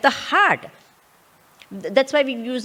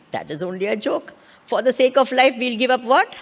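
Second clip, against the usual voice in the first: normal talking voice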